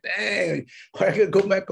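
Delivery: put-on high whining boy; whiny kid. Boy annoyed at going back to mom, whining. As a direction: complain like a tired kid. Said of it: "It's like".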